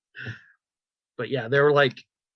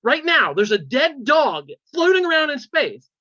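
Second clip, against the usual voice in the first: imitating stunned disbelief, rising high and louder; flabbergasted; 6 semitones higher, 3 dB louder than usual. Comedian's character learns the space dog died, flabbergasted. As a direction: act out an appalled outburst that builds in pitch and volume. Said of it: "she's just flabbergasted".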